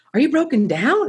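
high put-on stranger's voice; truck driver's question. Truck driver asks stopped traveler, high imitated concern.

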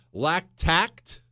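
ordinary talk, nothing marked

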